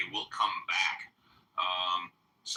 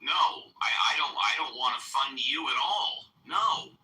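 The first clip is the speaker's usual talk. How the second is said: raised, indignant refusal; angry; 4 semitones higher than usual. Real, not acted. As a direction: shut him down, heated. Host refusing heckler's funding request.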